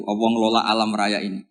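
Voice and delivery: ordinary talk, nothing marked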